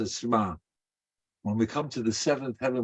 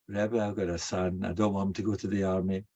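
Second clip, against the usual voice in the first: softer, fast, matter-of-fact ask; flat request; 5 semitones lower, 5 dB quieter than usual. Retold or imitated. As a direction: rattle it off with no real need. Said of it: "his whole voice would have changed".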